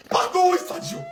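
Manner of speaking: excuses en pleurnichant, voix montée; faux sanglots. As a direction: pleurnicher l'excuse pour rire. Excuses répétées, deux prises. (pardon larmoyant et exagéré)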